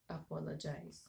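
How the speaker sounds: ordinary talk, nothing marked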